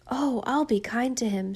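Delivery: usual speaking voice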